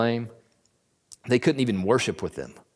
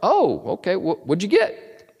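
high, puzzled wife's voice; wife asks her husband what car he bought, puzzled; curious wife; 9 semitones higher, slower than usual. Pretend. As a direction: play the wife asking, surprised. Wife voiced in imagined scene.

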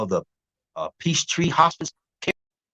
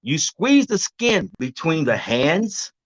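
slow demo; 3 semitones lower, slower than usual. Shown as a demonstration. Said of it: "I'm gonna do it slowly".

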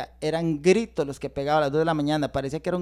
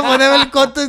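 loud, higher mock yell; imitated yell; 6 semitones higher, 9 dB louder than usual. Retelling his yelling in the yard, a mock yell. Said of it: "gritaba en el patio".

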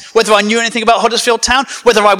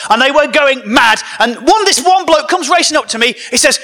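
normal talking voice

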